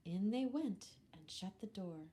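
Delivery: plain reading voice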